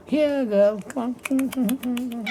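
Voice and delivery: high-pitched coaxing call to a cat; soft, high "come here" to a pet; calling softly. Acted. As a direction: coax a timid animal closer in a high, gentle voice. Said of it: "I called softly".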